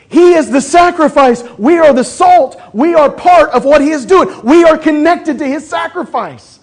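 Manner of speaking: high, loud, driving preacher voice; fiery pulpit delivery; preaching at full force. Acted. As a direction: preach it hard to the congregation.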